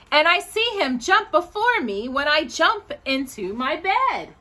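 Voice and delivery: put-on sing-song poem reading for children, lilting; reading a poem aloud. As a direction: read it like a bedtime poem to little kids.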